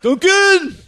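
high, loud East Coast shout. Pretend. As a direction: yell it like a rowdy fan. Joins the accent bit.

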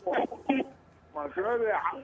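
usual speaking voice

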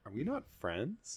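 very soft, mock-wounded voice; hurt question. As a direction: ask it small, with a rising lilt. Acts out Bruce's hurt question.

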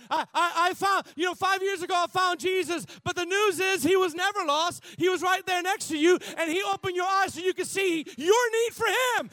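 loud, high, shouting preacher; full-force preaching. Acted.

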